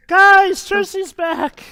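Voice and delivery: high, loud, thrilled call; excited announcement. Real, not acted. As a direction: shout it out to the group, delighted. Announcing return to friends.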